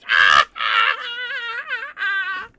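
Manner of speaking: loud, high cackling guffaw; hammed-up cartoon weasel laughter, very loud; imitated weasel guffaw. Film fan mimicking weasels laughing at a fool, shrieking guffaws. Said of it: "the weasels just start guffawing".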